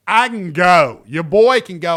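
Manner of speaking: loud, punchy brag; defiant boast. Real, not acted. Daring rivals to come at him, loud defiant brag. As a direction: throw it out loud like a challenge.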